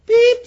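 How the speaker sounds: high, held beep; imitated alarm beep. Preacher flagging a cuss word as sin, imitated beep. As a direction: sound a high warning buzzer.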